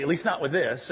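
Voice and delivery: ordinary delivery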